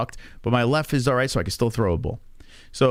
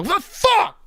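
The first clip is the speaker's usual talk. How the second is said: shocked yelp. Retold or imitated. Dad yelping as his shin hits the slide, high startled cry. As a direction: yelp it out like the leg just gave. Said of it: "I go".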